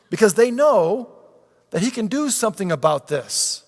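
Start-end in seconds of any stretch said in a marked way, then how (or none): none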